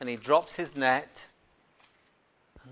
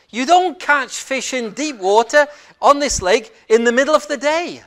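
loud, higher-pitched scoffing of an expert fisherman; indignant inner protest; 7 semitones higher, 9 dB louder than usual. Acted. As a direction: protest hotly, insisting you know better. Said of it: "What he's thinking is".

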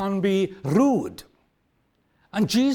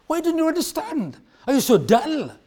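much higher, exasperated pleading; frustrated; 8 semitones higher than usual. Retold or imitated. Jesus frustrated with the disciples, exasperated. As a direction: near the end of his patience. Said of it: "bordered on frustration".

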